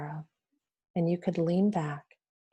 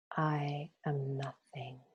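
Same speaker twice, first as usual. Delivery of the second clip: slower and much softer, fading out; fading mantra; 3 semitones lower, 8 dB quieter, slower than usual. Shown as a demonstration. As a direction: let it dissolve into silence. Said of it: "Say to yourself".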